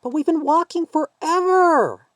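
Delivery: put-on high, slow, whining child's voice; whiny little girl. A narrator's reading. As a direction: whine like a tired little girl. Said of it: "the little girl whined".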